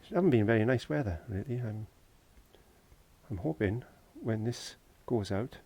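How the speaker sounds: ordinary talk, nothing marked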